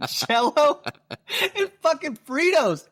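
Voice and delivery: high, laughing through words; can't stop laughing. Real, not acted. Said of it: "I can't stop laughing".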